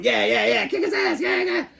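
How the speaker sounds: high, giggly cartoon teen snicker; laughing through a squeaky high cartoon voice; Beavis and Butthead impression. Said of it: "and they're going".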